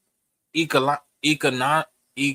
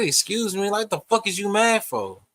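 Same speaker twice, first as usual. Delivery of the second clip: higher, irritated "Excuse me"; annoyed confrontation; 6 semitones higher than usual. Retold or imitated. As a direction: call her out with an edge. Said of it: "Like, I said".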